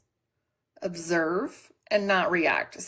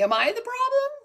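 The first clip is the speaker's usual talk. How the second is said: squeaky, much higher mock question; mock self-doubt; 3 dB louder than usual. Host mocks herself as the moody one, squeaky and high. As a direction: play it like a silly little voice. Jokey self-blame in acted quote.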